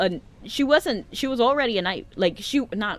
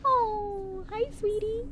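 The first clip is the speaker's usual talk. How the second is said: cooing; 12 semitones higher, 5 dB quieter, much slower than usual. Real, not acted. Player greets a plush dragon, cooing. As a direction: coo at it in a much higher voice.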